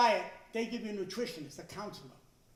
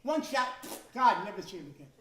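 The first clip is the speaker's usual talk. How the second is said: normal talking voice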